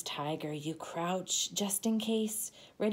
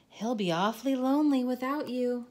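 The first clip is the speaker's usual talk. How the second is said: quick coaxing; 5 semitones higher, 4 dB louder, faster than usual. Acted. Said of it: "I say".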